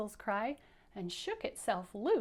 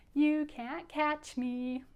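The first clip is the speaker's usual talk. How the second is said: slow, higher sing-song tease; playful taunt; 5 semitones higher, much slower than usual. Shown as a demonstration. Pickle thumbs its nose at pursuers, sing-song taunt. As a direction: taunt them in a drawn-out chant. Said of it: "Can you do that like him?".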